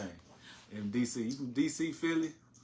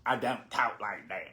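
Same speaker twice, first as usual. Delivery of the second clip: slow, lower-pitched mimicry; mock-careful echo of someone; mocking imitation; 5 semitones lower, slower than usual. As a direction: mimic a prim denial slowly and low. Mocking her careful denial.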